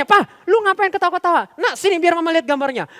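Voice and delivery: high, loud mock scolding; scolding mother. Mother scolds the laughing father, high loud telling-off. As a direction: snap at him like an annoyed mum. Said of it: "Mamanya marahin papanya".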